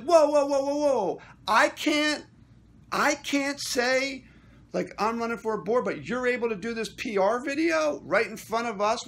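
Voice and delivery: high, loud retold outburst; indignant objection. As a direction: cut in loudly, re-enacting your protest. Acting out his own indignant interruption.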